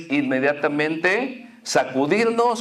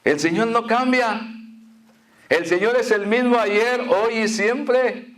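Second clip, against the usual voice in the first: raised, fervent preaching; 5 semitones higher than usual. Acted. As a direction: proclaim it high and strong. Preacher raising voice on God's constancy. (predicando con fervor)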